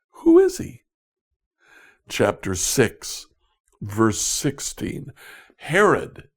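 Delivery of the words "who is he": shrill, much higher disbelieving question; incredulous. Retold or imitated. Nazareth villagers scoffing at the carpenter, high and incredulous. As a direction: squeak it out in disbelief.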